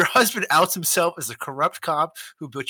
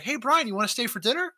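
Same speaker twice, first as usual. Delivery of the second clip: higher, sing-song invitation; mock friendly host; 8 semitones higher, faster than usual. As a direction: play the oblivious friendly guest. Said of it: "He's like".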